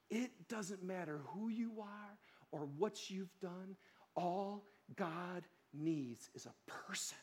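soft and slow, close to the mic; quiet earnest appeal. Real, not acted.

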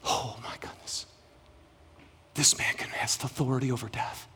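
hushed, breathless amazement; awed whisper. Retold or imitated. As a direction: whisper it as a secret wonder. Believers marvelling in hushed awe.

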